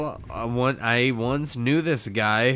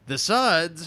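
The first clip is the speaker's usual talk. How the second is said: imitating Gary's owner; 6 semitones higher than usual. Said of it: "He's like".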